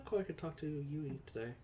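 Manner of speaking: ordinary talk, nothing marked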